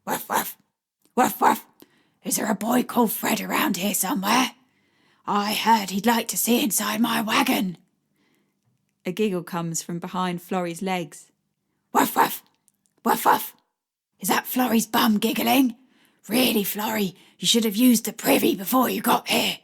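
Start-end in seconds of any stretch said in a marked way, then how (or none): 0.1-7.8 playful put-on dog voice with barks; woman voicing a china dog for a shy child; doggy voice. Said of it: "my best version of a doggy voice". 11.9-19.6 playful put-on dog voice with barks; woman voicing a china dog for a shy child; doggy voice. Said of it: "my best version of a doggy voice".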